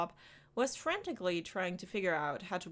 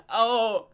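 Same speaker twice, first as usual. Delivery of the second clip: delighted gasp and laugh; 4 semitones higher, 9 dB louder than usual. Acted. Delighted outburst.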